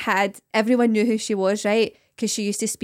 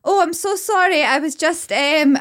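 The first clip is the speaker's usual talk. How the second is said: higher, flustered, mock-embarrassed apology; 6 semitones higher, 4 dB louder, slower than usual. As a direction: catch yourself and apologise in a fluster. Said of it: "And then you go".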